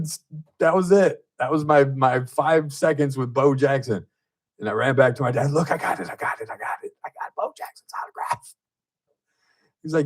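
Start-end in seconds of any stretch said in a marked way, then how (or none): 5.5-8.5 breathless whispered excitement, higher; gasping out the news to dad; boy whispering to his dad that he got the autograph; breathless kid. Retold or imitated.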